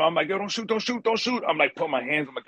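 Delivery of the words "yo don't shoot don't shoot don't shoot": high, frantic pleading; panicked surrender shout. Retold or imitated. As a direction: beg him not to shoot, voice jumping up. Held at gunpoint by brother.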